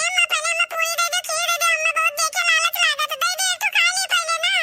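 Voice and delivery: put-on shouting child whine; whiny child voice. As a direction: pester mom like a hungry brat. Child begging mother for food.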